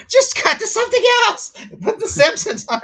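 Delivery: high, loud mock outburst; comically strained pleading shout; exasperated yell. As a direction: holler at the television in fed-up desperation. Exasperated viewer yelling at broadcast.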